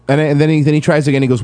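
normal talking voice

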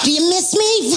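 put-on girlfriend voice. Comic imitates girlfriend on the phone, mock sweet.

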